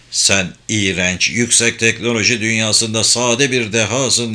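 plain reading voice

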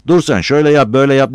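loud, high bossy imitation; mocking orders. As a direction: parody someone giving orders. Mimics the headman's expected orders.

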